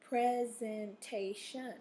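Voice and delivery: Presentation drawn out slowly, each syllable apart; slow syllable count of Presentation. Shown as a demonstration. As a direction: stretch out Presentation so every syllable is heard. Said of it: "think about how many syllables".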